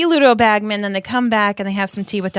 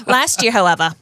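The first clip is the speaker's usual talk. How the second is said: dramatic New York gossip read; Rita Skeeter. Acted. Rita accusing Hagrid in her article, New York accent. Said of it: "Rita has a New York accent".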